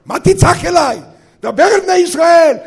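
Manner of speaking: loud, high exasperated rebuke; scolding. Acted. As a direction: snap a sharp order back at the one shouting. God rebukes the crying leader. (נזיפה רמה בקול גבוה)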